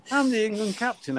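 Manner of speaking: high-pitched mock indignation; indignant protest. Captain being booked by referee, indignant protest.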